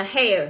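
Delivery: "hair" pronounced as an English word; model pronunciation. Shown as a demonstration. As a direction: say "hair" as a teacher's reading model. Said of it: "đọc là". (phát âm mẫu từ "hair")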